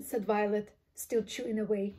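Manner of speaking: plain reading voice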